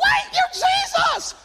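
squeaky high mock shout of surprise; shocked disbelief. Disciple realizing Jesus needs the bathroom, high shocked cry. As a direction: gasp it out, way up high and loud. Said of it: "You're like".

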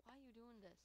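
normal talking voice

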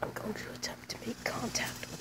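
hushed wildlife narrator whisper; whispered documentary narration. Acted. Narrator whispers approaching squirrel.

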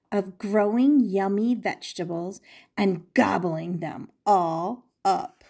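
usual speaking voice

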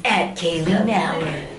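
low movie trailer announcer. Pretend. Announcing a social media handle, low trailer voice. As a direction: drop the pitch and announce it like a trailer. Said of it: "you get the trailer voice".

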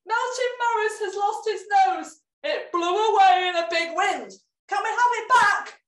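high, loud urgent plea; urgent pleading. A narrator's reading. Marvin begs Cakey Cora for the nose, high and loud. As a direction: beg her to stop grating.

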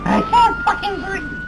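very high, loud, slow panicked yell; shrieking that she can't breathe. Acted. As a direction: scream it out in a panic. Chaos as cops arrive.

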